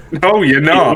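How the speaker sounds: high, laughing no you're not; laughing mock retort. Retelling the standoff to mates, laughing high.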